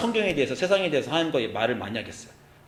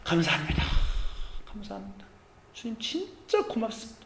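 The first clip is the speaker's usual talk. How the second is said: 1 hushed thanks; 5 semitones higher, 7 dB quieter than usual. Retold or imitated. Tearful thanks after repentance.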